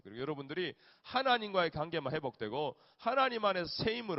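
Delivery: loud, driving sermon voice; fervent preaching. Acted. Pastor exhorts parents and students, loud preaching. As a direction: preach hard and call for amen.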